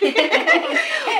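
high, loud burst of laughter. Real, not acted. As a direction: break out laughing. Laughs at the sleepy phone call.

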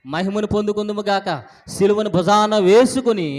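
loud, high, rousing declarations; full-force preaching. Real, not acted. Preacher urging the congregation to desire hardship, rousing and forceful.

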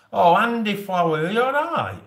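high, loud mock concern; Andy Flower teased with mock alarm. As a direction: gasp out a hammed-up worry.

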